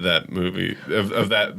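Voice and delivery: ordinary talk, nothing marked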